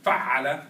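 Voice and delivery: Fa'ala called out loud, a-a stretched; model word for repeating. Shown as a demonstration. Teacher calling out a word for the class to echo, loud. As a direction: lead the class chant of Fa'ala. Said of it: "So repeat".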